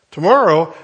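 acted kid who can't believe it; mock dismay. Kid told to come back.

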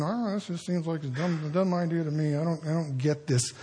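soft grumbling complaint; under the breath. Retold or imitated. Believer complaining under the breath about God's plan. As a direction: mutter it like a secret gripe. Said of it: "that kind of under the breath complaining".

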